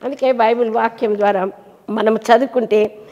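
usual speaking voice

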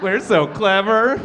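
laughing, smug and slow, mocking the couple; smug mock parents. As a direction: play the self-satisfied parents with a laugh. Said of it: "mocking smug parents".

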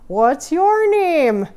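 drawn-out Wisconsin neighbour asking; Wisconsin accent. Pretend. Neighbour asking the lumberjack's name, Wisconsin accent. Voicing the people she meets.

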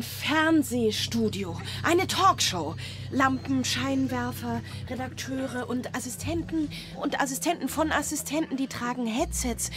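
rising thrill at the big show; excited. Acted. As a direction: marvel at the TV studio.

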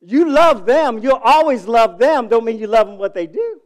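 loud, high, preaching it out; rising preacher's call; emphatic preaching. Real, not acted. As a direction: drive home the love, full voice. Preacher stressing love for children.

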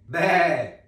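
loud high goat bleat. Shown as a demonstration. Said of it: "Fa così". (belato di capra forte e acuto)

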